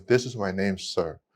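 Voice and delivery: softly mumbled by a shy student; timid quiet reply. Retold or imitated. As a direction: say it meekly, barely audible. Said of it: "I can't hear you".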